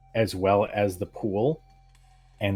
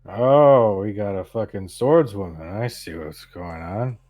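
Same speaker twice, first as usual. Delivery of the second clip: mocking drunk, loud at first; drunken man; 7 dB louder, slower than usual. Drunk man sees the swordswoman draw, mocking drunken jeer. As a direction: jeer at the challenger, slowing at the end. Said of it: "He's got a bottle of alcohol".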